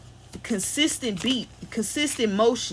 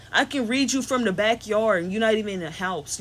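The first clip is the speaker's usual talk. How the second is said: ordinary talk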